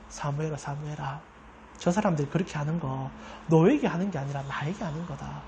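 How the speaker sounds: low, soft, reassuring voice of God; God comforting. Retold or imitated. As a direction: speak gently to console Samuel. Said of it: "그래서 얘기하지 않습니까". (사무엘을 달래는 낮고 부드러운 하나님의 목소리)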